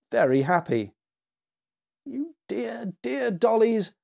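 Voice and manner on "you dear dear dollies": high, softened fondness; doting child's high voice; tender little girl. A narrator's reading. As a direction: coo to beloved dolls, pitched high. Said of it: "as she hugged each one in turn".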